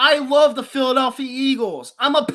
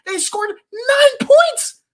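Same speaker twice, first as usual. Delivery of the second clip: much higher, slow, stretched disbelief; can't believe the score; they scored nine points, stunned; incredulous outburst; 12 semitones higher, 3 dB louder, much slower than usual. Real, not acted. Incredulous rant.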